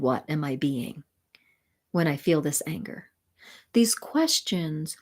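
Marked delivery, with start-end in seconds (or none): 0.0-3.1 soft inward whisper. Acted. Coach guiding students inward, whispering. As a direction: breathe the question to yourself. Inward questioning during guided exercise.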